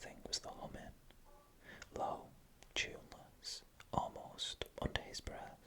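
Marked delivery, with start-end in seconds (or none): none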